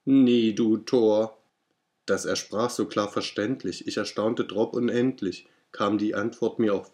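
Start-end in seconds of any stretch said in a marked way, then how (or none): 0.1-1.4 slow, louder croaking raven voice; raven's croak. Acted. Raven answers the grieving narrator, croaking slowly. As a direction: drag out each word like the raven.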